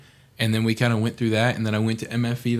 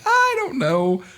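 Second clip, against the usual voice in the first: put-on much higher, helpless kid voice; a hint of a clueless eighth grader; sheepish younger self; 4 dB louder, slower than usual. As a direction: shrug it off like a lost teenager. Answering his mom's criticism.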